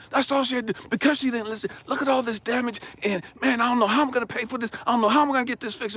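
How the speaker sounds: high, agitated protest to God; worked-up parent defending the anger; indignant self-justifying. Retold or imitated. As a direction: plead your case in a heated rush. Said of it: "I'm justifying my anger".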